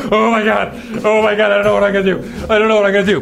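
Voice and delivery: high mock panic, near hyperventilating; panicking. Buddy panicking in the Saudi cell, high frantic. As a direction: retell his panic in a frantic rush. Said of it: "my buddy starts panicking".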